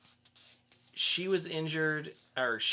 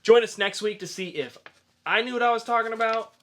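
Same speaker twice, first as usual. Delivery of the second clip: upbeat host sign-off; podcast outro; 5 semitones higher, 8 dB louder than usual. Acted. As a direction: wrap up the show for the audience.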